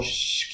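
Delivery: short hushing burst of air; forceful shh. Shown as a demonstration. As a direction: push a shh out from a full breath. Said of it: "very forceful".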